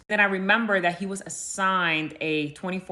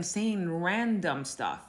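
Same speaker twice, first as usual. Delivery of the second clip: normal talking voice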